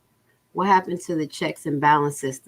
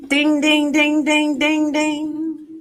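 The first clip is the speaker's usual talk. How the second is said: mock bell ding; 10 semitones higher, 4 dB louder, much slower than usual. Mimics a bell.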